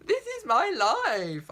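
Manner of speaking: hammed-up tragic wail; theatrical slow cry of despair; mock despair. Repeats tragic protest.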